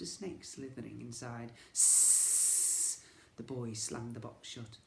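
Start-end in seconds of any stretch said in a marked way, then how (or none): 1.7-3.0 unvoiced long hiss; snake hiss. A narrator's reading. Narrator voicing the snakes in the box.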